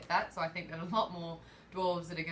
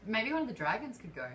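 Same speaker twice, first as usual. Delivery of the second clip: normal talking voice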